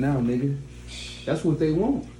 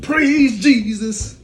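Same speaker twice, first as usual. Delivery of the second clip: very slow, high, loud praise; 8 semitones higher, 5 dB louder, much slower than usual. Retold or imitated. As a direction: drag out each word in mock devotion.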